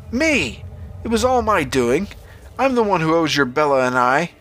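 high, urgent confession; urgent plea. A narrator's reading. Father offering himself to save his son, high urgent plea. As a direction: start high and insistent, easing down.

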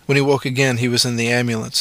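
plain reading voice

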